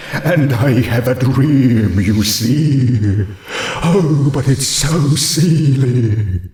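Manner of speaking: slow, shy ghostly mare; a hint of a hesitant ghost. Ghost mare shyly confiding to Rarity, slowed.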